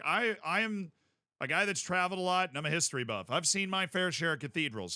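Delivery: ordinary delivery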